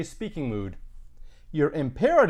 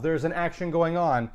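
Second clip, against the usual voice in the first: ordinary delivery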